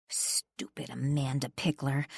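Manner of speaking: muttered to myself. A narrator's reading. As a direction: mutter it under your breath. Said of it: "I muttered to myself".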